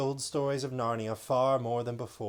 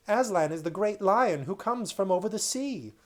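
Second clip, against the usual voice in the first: put-on eager child; 8 semitones higher, 5 dB louder than usual.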